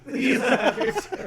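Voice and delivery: laughing. Acted. Echoing mockingly, laughing.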